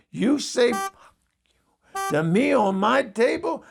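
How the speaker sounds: high, whispered outrage; outraged whisper. Retold or imitated. Uncle outraged at child's spelling.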